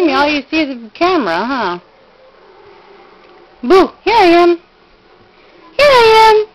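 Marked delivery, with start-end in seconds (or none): 3.6-6.5 loud, bright peekaboo; playful peekaboo. Real, not acted. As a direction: pop out playfully. Peekaboo game with baby.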